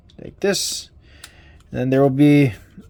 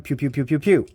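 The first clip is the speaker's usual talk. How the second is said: blaster pew noises; faster than usual. Pretend. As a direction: fire off toy blaster sounds under your breath. Blaster fires in toy action scene.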